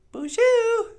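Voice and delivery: very high, loud cartoon puppet greeting; high puppet bonjour. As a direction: squeak it out like a bubbly puppet. Puppet character voice.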